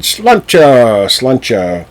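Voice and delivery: a tipsy clink-and-drink cry; drinking cheer. A narrator's reading. Robbers drink to the steward, with a loud cheer. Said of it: "raised a glass of ale and said".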